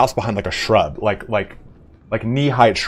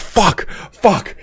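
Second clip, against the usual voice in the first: higher-pitched fuck fuck after the fall; pained yelp; 9 semitones higher than usual. Retold or imitated. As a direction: yelp it from the cactus spines. Falls into a cactus.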